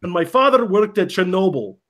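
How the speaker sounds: put-on deadpan joke in Russian accent. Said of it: "I can do a Russian accent".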